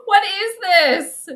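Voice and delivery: real laughing despair; laughing wail. Real, not acted. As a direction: drag it out while cracking up. Repeated while laughing.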